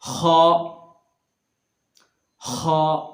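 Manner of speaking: खा scraped out from the upper throat; scraped kha. Shown as a demonstration. Teacher demonstrating the letter, scraped from throat. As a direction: clear the throat into खा. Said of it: "आगे है".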